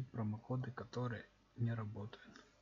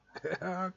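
excited laugh; 9 semitones higher, 5 dB louder than usual. Real, not acted. Laughs after winning jackpot.

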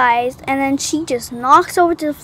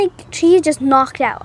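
normal talking voice

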